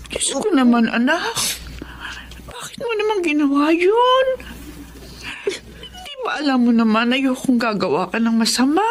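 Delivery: rising into a tearful plea; a mother's heart breaking, whisper breaking through; shocked, tearful scolding; distressed mother. Acted. Mother learns son steals phones.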